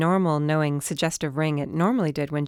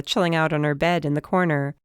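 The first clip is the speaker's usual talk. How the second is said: ordinary delivery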